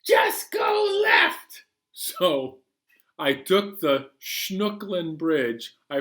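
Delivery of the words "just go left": high, loud cartoon passenger, slow and sure; odd passenger voice. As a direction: squeak it out like a goofy know-it-all.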